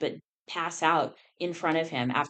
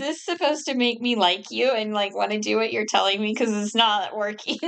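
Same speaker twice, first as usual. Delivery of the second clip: sarcastic; 3 semitones higher, 4 dB louder than usual. Retold or imitated. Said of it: "It's like".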